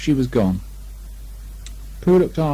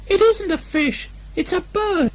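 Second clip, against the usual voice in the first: very high, slow squeaky piglet; tiny anxious squeak; small piglet voice. A narrator's reading. Piglet insists to Rabbit, very high squeak.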